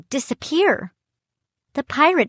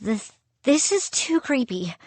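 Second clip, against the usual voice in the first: higher, uneasy stammering; frightened, halting and pitched up; nervous stammer; 5 semitones higher, 4 dB quieter than usual. A narrator's reading. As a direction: stumble on the first word, creeped out. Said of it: "I stammered".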